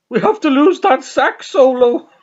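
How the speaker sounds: high, loud mock yell; hammed-up urgent demand; acted outburst. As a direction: yell it over the top. Acted outburst about song in sitcom.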